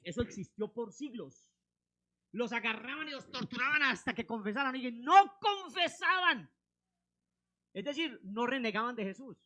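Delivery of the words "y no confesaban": high, loud, drawn-out; slow loud emphatic. Real, not acted. Preacher stresses martyrs' defiance, slow loud. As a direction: hammer it slowly, full force.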